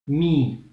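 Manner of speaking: mi with the i held long; long vowel mi. Shown as a demonstration. Teacher shows mim with ya. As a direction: stretch the i in mi. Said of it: "avec lia".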